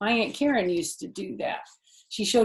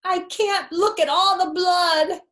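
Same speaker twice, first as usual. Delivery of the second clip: high, loud, overacted distress; melodramatic whine; 10 semitones higher, 9 dB louder than usual. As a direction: ham up the panic over a scratch. Fox dramatizing his scratch.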